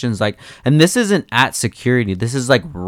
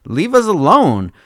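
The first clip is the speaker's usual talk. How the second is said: much higher, exasperated outburst; exasperated protest; 10 semitones higher than usual. Retold or imitated. Refusing the police search, exasperated and high. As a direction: plead exasperated, voice shooting up. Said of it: "I'm like".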